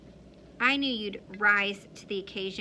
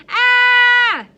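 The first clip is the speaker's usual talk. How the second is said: short sudden shriek, much higher and louder; mock scream; 13 semitones higher, 18 dB louder than usual. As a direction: let out a startled kid's scream. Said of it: "I was like".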